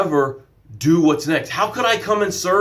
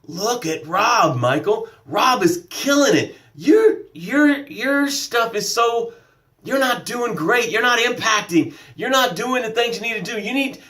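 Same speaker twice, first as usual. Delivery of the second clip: higher-pitched nagging inner critic; self-doubt's voice; 6 semitones higher than usual. Acted. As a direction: needle him in a higher voice. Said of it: "So Am I Enough showed up and said".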